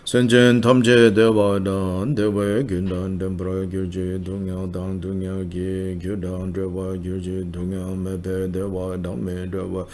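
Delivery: ordinary talk, nothing marked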